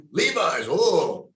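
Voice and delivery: put-on awed Russian accent, high swooping pitch; hammed-up Russian wonder. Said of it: "That was a great Russian accent".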